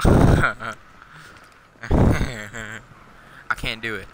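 laughing through words. Real, not acted. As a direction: crack up mid-line. Laughing at game.

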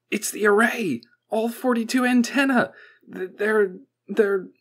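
high, loud, stammering alarm; panicked report. A narrator's reading. Technician bringing bad news to the professor, panicked.

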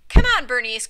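loud eager call; crying out. A narrator's reading. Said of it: "cried Otis".

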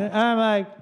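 normal talking voice

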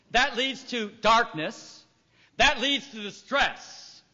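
slow, each step weighed; measured steps. Shown as a demonstration. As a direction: lay out each stage slowly. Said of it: "slow cycle recital".

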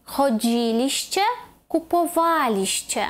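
choDZIliście, kupoWAliście, slowly; third-from-last stress. Shown as a demonstration. Teacher modelling second person plural, stress third from end. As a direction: stretch DZI and WA in "Chodziliście, kupowaliście". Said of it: "а на третій".

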